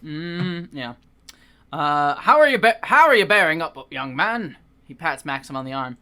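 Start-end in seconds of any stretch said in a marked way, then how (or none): 2.1-4.5 redone British vicar. Acted. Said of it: "my voice is just all over".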